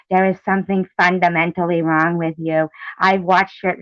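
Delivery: sneering impression of a critic; mocking Tiffany. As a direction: parody her preachy tone. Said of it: "Tiffany going".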